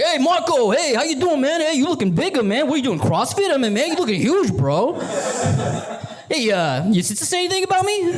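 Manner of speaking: high, swaggering bro voice; hometown guy voice. Pretend. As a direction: play the local hotshot hyping up a friend. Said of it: "I'm like".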